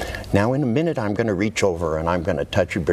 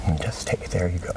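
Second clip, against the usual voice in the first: soft hushed coaxing; whispering; 4 dB quieter, faster than usual. Real, not acted. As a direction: whisper it gently. Hypnotist inducing trance.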